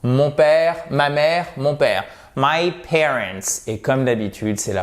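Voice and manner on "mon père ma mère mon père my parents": ordinary delivery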